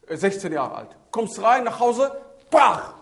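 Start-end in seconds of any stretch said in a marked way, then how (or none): 2.5-2.9 loud, sharp slap noise; imitated slap. Father hits son on coming home, loud imitated slap. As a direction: burst out a sudden smack sound. Ein Klatsch.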